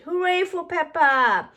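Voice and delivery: loud, high cheering voice of the crowd. A narrator's reading. The mud-covered crowd cheering for Peppa's splash, loud cheer.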